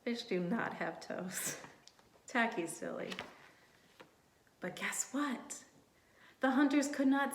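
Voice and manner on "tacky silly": ordinary delivery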